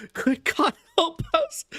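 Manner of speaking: high, desperate pleading; frantic prayer. A narrator's reading. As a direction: beg heaven in panic. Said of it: "beat his hands wildly together".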